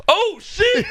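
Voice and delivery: hammed-up startled viewer; mock panicked yell.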